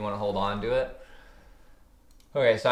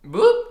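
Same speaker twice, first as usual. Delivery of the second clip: sound effect; 12 dB louder than usual. Retold or imitated. Mimics price spiking up.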